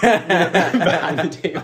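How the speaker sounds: loud, high, laughing repeat; cracking up mid-phrase. Real, not acted. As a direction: drag it out slowly through helpless laughter. Cracking up at a silly name.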